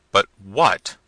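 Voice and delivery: loud, demanding prince; impatient cry. A narrator's reading. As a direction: cut in loudly, demanding an answer. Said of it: "cried the prince".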